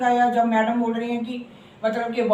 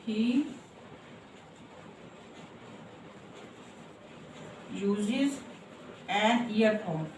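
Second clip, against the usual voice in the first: "he uses an earphone" spoken slowly and softer; soft slow dictation; 2 semitones lower, 12 dB quieter, much slower than usual. Shown as a demonstration. Teacher dictating the example to the class, softly and slowly. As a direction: dictate gently word by word. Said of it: "जैसे".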